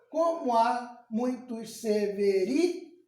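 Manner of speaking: "Como há muitos severi" slow and measured, rising on RI; slow verse scansion, cut off after RI; scanning by syllables. Shown as a demonstration. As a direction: pace out each syllable up to a stressed RI. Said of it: "de novo".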